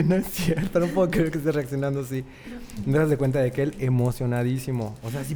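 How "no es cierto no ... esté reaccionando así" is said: somewhat higher, amazed disbelief; can't believe the brother's reaction. Retold or imitated. Retelling his own reaction to the brother, amazed. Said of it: "Y yo no me la creía".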